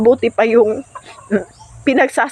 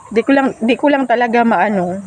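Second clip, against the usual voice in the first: ordinary delivery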